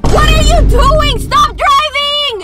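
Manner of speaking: high, loud, frantic shout; angry yell. Real, not acted. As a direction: yell at him to stop. Yelling at driver to stop.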